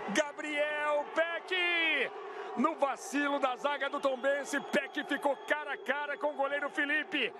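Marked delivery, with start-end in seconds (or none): 0.1-2.1 long stretched stadium shout; drawn-out goal cry. Real, not acted. Goal cry in live broadcast. (grito de gol prolongado)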